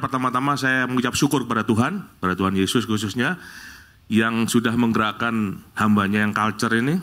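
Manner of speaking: ordinary delivery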